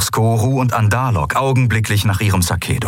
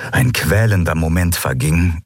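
plain reading voice

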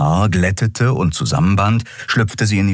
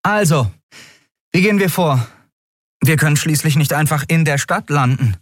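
put-on lightly raised young woman's voice; a hint of a tired captain waking up; captain Endriel voice; 9 semitones higher than usual. Captain Endriel asking the crew about breakfast, lightly voiced.

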